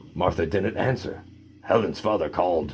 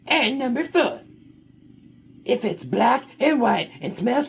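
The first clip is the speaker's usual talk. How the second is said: high, lively storybook read; reading to children; 13 semitones higher than usual. Acted. Parent reading the dog's story to kids, high and lively. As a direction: tell the puppy's tale brightly to the kids.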